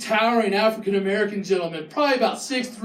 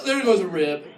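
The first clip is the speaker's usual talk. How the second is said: ordinary talk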